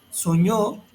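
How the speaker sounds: teacher's example, "Soñó" rising onto "ñó"; stress on final syllable. Shown as a demonstration. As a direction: hit the last syllable of "Soñó". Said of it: "acento en la última sílaba o vocal".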